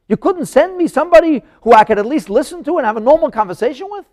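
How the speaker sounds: loud, high-pitched indignant outburst; angry Pharaoh. Acted. Pharaoh protesting at the stammering leader sent to him, angry and loud. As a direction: erupt in insulted, incredulous disbelief. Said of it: "the Pharaoh was angry".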